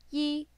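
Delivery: yi held high and level; high flat tone on yi. Shown as a demonstration. Teacher models number one for learners, high flat yi. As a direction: say yi on a high, even pitch. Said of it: "That's a high flat tone".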